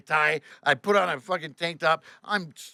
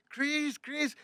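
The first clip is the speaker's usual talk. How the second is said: high, soft imitation of a fretting man; worried Frenchman; 11 semitones higher, 7 dB quieter than usual. French guy fussing over the sick driver, high and anxious. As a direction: call his name anxiously in a put-on high voice. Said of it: "this guy goes".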